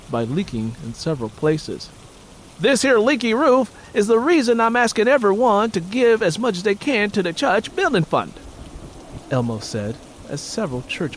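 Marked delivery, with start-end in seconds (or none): 2.6-8.3 high, louder preaching voice; preacher. Acted. Preacher asking congregation for money, loud and high. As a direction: preach for the building fund.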